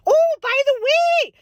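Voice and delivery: high, bright burst; an excited Minnesota-mom "oh"; sudden cheer. Real, not acted. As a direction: jump happily to the fun news. Sudden cheerful change of topic.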